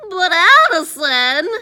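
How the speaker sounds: loud, high, drawn-out plea; desperate protest. A narrator's reading. Eddie protesting as Addison reaches the door, desperate. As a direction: wail the name in protest.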